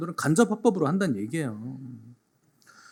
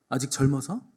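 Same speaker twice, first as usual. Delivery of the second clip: ordinary delivery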